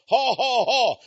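high put-on laugh; ha ha ha in a taunting high voice; mock laugh. Preacher mimics a gloating laugh to the congregation, taunting.

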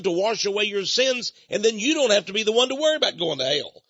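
high, forceful radio-preacher rebuke; fiery preaching. Real, not acted. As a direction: admonish the doubters, pitch climbing and held high.

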